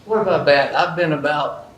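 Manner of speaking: loud, pumped-up showman boast; excited hype. Real, not acted. Sponsor hyping his joke record to the audience, loud and excited.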